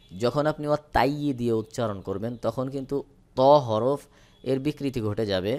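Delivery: normal talking voice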